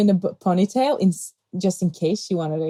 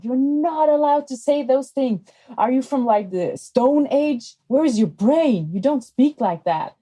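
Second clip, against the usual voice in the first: higher, sharp scolding of a rude stranger; retold scolding; 6 semitones higher than usual. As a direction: tell her off hard, a teacher defending a child. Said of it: "And she screamed at her".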